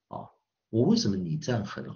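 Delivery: ordinary talk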